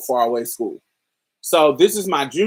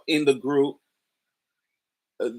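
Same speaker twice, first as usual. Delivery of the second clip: usual speaking voice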